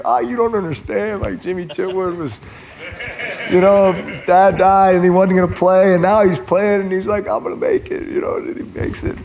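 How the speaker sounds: high-pitched, mock-choked-up protest; tearful retelling. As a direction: act out blubbering over a movie, voice climbing high. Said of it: "tears, like, running down my face".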